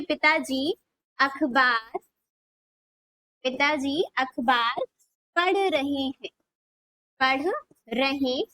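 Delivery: words stretched with pauses between; slow dictation. Shown as a demonstration. Teacher dictating Hindi sentence.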